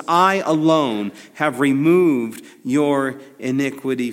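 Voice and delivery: "I alone" drawn out and weighted; slow, punched delivery, hardest on I; emphatic I alone. Acted. Preacher quoting the Hebrew of God's promise, emphatic I alone. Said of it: "it's an emphatic in the Hebrew".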